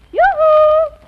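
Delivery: high, loud singsong holler; calling out. Acted. Wife summoning David from the window, hollering. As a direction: send it out to the neighbours.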